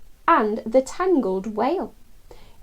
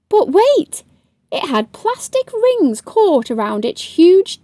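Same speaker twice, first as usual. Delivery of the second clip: surprised; 7 semitones higher than usual. A narrator's reading. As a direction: stop short in alarm. Sudden discovery in story.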